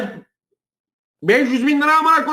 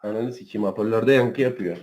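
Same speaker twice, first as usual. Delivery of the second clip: phone echo; 6 dB quieter than usual. Real, not acted. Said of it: "Yankılanıyor ya".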